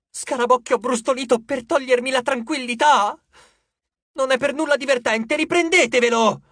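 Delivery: high, loud, indignant rant at the heavens; shouted tirade. A narrator's reading. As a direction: rail at the gods, building to a demand. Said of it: "gridò la ragazza al cielo". (invettiva gridata)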